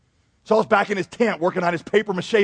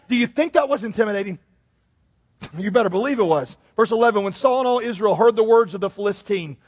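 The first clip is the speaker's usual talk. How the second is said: normal talking voice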